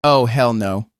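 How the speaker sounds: flat refusal. Acted. Narrator reacts in horror.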